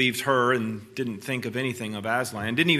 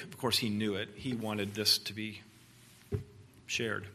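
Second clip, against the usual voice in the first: ordinary delivery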